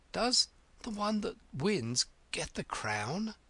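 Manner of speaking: high, halting, breathless question; out of breath. A narrator's reading. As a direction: ask in broken bits while running. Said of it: "putting her quite out of breath".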